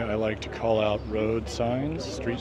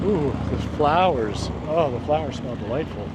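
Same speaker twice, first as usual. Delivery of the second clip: delighted ooh; 4 semitones higher, 7 dB louder than usual. Real, not acted. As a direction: savour the scent out loud. Stops to smell street flowers.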